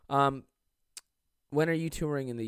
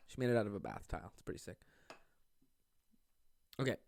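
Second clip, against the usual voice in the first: ordinary talk, nothing marked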